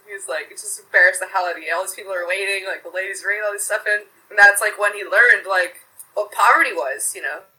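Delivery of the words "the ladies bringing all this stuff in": ordinary talk, nothing marked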